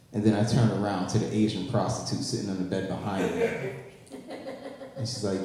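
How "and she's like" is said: ordinary talk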